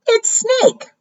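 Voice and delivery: very high mouse voice; high-pitched announcement; chirpy little mouse. Acted. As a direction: pipe up high as a small storybook mouse.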